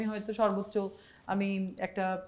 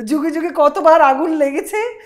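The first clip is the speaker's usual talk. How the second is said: louder, higher theatrical recital; jatra dialogue; 5 semitones higher, 9 dB louder than usual. Shown as a demonstration. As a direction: declaim it for the listener to copy. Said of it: "এটা বলো".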